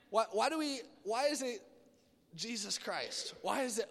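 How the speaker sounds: ordinary delivery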